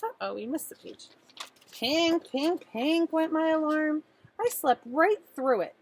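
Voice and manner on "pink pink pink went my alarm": higher, louder sing-song alarm; alarm ringing. Pretend. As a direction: ring it out like a clock going off. Alarm sound.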